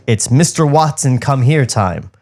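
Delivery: usual speaking voice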